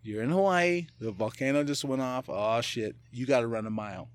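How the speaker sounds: ordinary talk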